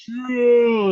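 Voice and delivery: high whooping yeah; cheering yeah. Retold or imitated. Crowd cheering his bike jump, high whoop. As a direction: cheer him on as he jumps.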